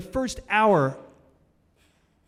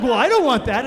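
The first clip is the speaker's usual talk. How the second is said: protesting; 8 semitones higher, 10 dB louder than usual. Retold or imitated. Replying to his brain.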